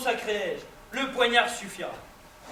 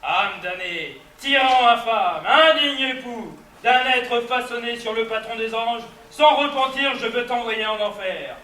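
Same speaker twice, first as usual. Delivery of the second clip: furious tirade; 5 dB louder than usual. Acted. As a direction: hurl the accusations in fury. Furious challenge to a duel.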